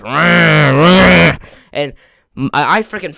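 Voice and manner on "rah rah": loud growling engine roar; imitated motor roar. Boy imitating the bulldozer motor starting, loud engine roar. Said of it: "giant loud motor just turns on and goes".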